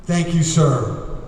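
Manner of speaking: normal talking voice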